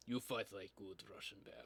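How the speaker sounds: put-on low, quiet Russian accent; Nikolai. Imitating a zombie-game soldier praising a fighter, Russian accent. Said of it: "Like Nikolai from Zombies".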